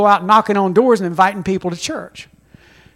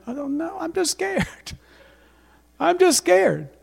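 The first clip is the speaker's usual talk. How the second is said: sheepish admission; 7 semitones higher, 6 dB quieter than usual. Retold or imitated. Confessing fear, laughs.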